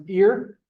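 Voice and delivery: "ear" said as English "here" without the H; H dropped. Shown as a demonstration. Teacher showing the class how "here" loses its H, H dropped. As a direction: say "ear" with no H at the front. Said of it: "If you take off the, the, the H".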